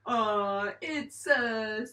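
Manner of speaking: loud, slow, over-enunciated radio host; mock a pompous radio announcer; radio over-enunciation voice. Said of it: "your radio over enunciation voice".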